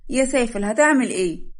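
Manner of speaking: loud, high indignant bride; alarmed protest. Acted. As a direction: scold him in loud alarm. Bride protests his touch. (احتجاج مستنكر بصوت عالٍ)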